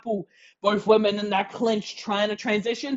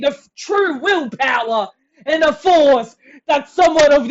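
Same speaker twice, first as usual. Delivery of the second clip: loud, high ring announcer hype; big arena introduction voice; ring announcer intro; 5 semitones higher, 6 dB louder than usual. Acted. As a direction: build the champion up for the crowd.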